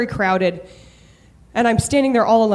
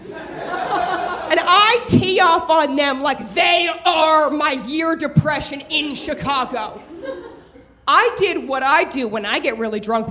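normal talking voice

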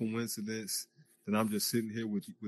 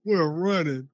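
We're running, louder and higher; excited shout; 6 semitones higher, 7 dB louder, slower than usual. Acted.

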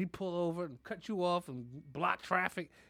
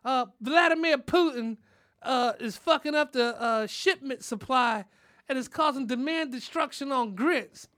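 lightly voiced, higher wife explaining; a touch of patient wife explaining the news; wife explaining grit shortages to husband; imitating grandma; 7 semitones higher, 6 dB louder than usual. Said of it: "And she said".

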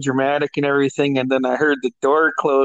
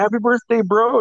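lightly voiced buddy, higher; a cheery bro greeting, pitched up; friend's greeting; 8 semitones higher than usual.

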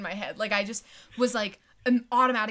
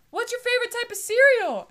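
principal asking; 14 semitones higher, 8 dB louder than usual. Retold or imitated. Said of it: "he was like".

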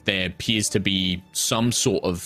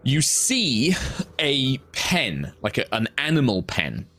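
dramatic scene setting; 5 semitones higher than usual. Acted. GM sets a grim scene.

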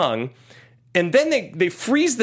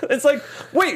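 laughing disbelief; 11 semitones higher than usual. Real, not acted. Mocking the Aussies' plan.

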